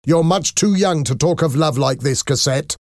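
high-pitched parental scolding; angry. A narrator's reading. Father scolding his daughter about love, angry. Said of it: "Valjean shouted angrily".

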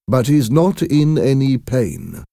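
ordinary delivery, nothing marked

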